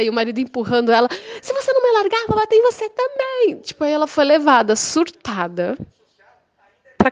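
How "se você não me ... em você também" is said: much higher threatening shriek; mock raging neighbor. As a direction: fight free of the husband, shrill.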